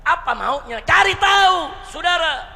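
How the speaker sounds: high, shouted sermon delivery; full-force preaching. Real, not acted. Preacher teaching the congregation how leaders act, forceful shouting. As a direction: drive each phrase home loudly.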